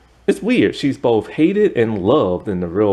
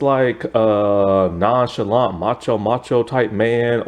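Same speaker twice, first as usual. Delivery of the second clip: normal talking voice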